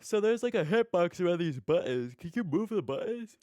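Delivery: words stretched through a yawn. Real, not acted. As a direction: ask it mid-yawn. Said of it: "I yawned there".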